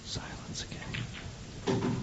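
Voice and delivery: whispered, very slow, barely there; hushed whisper. Real, not acted. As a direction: breathe the words under the tension. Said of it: "hushed commentary moment".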